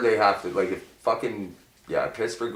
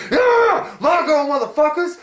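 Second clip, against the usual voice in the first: very high, loud whooping yell; hockey player hollering at rivals, very high; mock shout; 5 dB louder than usual. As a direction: whoop it like a crazed goalie. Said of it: "he's gonna be like".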